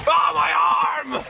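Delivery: sudden high cry of pain; pained yell. Real, not acted. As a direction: yelp as the arm gets hurt. Hurts arm while pushing boat.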